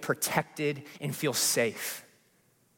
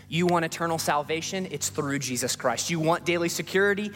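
preaching at full force, higher and building over swelling music; rising preaching; 6 semitones higher than usual. Acted. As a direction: build the altar-call cadence line by line. Repeated preaching refrain.

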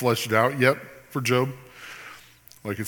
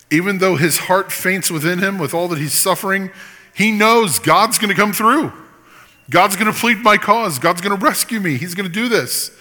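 louder and higher, building with conviction; impassioned preaching; 7 semitones higher, 3 dB louder than usual. Acted. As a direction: preach it to the back row.

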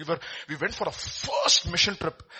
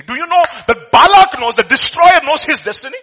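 much higher, much louder preaching cry; preaching shout; 11 semitones higher, 14 dB louder than usual. Real, not acted. Preacher crying out to his congregation, loud. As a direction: roar it out to the congregation.

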